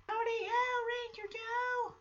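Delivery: high, soft sing-out hello; imitated children's greeting. Children greeting Ranger Joe, imitated in a high soft voice.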